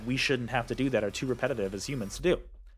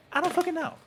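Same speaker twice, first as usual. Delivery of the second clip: exasperated outburst; 14 semitones higher, faster than usual. Real, not acted. Exasperated rant.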